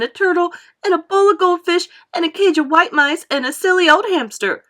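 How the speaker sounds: loud, high whining tantrum. A narrator's reading. Spoilt girl throws a tantrum at Mummy, loud and whiny. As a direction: stamp your foot and whine.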